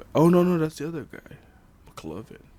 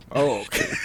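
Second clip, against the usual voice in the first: mock Harry voice. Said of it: "And Harry's like".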